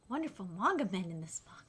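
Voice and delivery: fast, soft whisper. Real, not acted. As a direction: rush it out under your breath. Aside about many good men.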